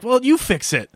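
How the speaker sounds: high-pitched imitation of a demanding woman; mock demand. Imitating her when the car won't start, high mock demand. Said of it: "she's like".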